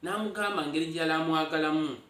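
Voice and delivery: usual speaking voice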